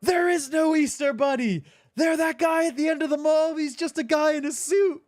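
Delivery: imitating an angry man losing it, high and loud; mock angry outburst. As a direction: play the pissed-off guy yelling in a squeaky voice. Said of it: "he gets pissed off".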